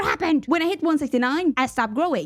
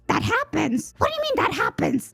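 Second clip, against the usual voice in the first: high, incredulous repeat rising higher; baffled echo; 8 semitones higher than usual. Retold or imitated. Patient baffled by doctor's shrug, incredulous. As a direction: throw it back in baffled disbelief. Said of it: "I was just still baffled".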